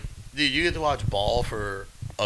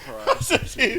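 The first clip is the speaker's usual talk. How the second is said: laughing; 13 semitones higher, slower than usual. Real, not acted. Rereading the funny credit names.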